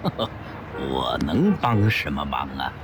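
light chuckle, then low; with a laugh. A narrator's reading. Businessman chuckling at the monk's offer, amused. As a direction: laugh it off modestly. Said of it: "齐长鹏说着一笑".

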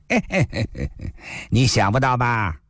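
a quick tittering "heh-heh" into a taunt; chuckle, then gloat; gleeful chuckle. A narrator's reading. One-eyed woman gloats at the newcomer, gleeful chuckle. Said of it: "独眼妇人乐了".

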